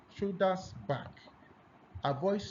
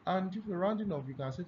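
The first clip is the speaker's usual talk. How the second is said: ordinary delivery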